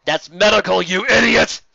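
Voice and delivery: loud scolding shout; shouted insult. Acted. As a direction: snap it at the idiot. Shouted correction.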